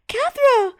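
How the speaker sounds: put-on high, loud child's cry of alarm. A narrator's reading. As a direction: shout her sister's name in panic. Girl cries out for sister.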